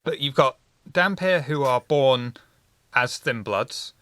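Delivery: ordinary talk, nothing marked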